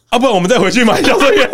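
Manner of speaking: quoting dad through laughter, pitched high; can barely get the words out for laughing. Retold or imitated.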